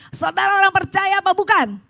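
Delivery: preacher demanding a livelier answer; rousing call. Real, not acted. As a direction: fire up a limp congregation with a forceful shout. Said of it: "sekarang jangan lemes".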